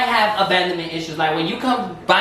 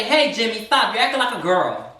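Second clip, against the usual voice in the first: stern parent; 5 semitones higher than usual. Retold or imitated. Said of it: "they don't say".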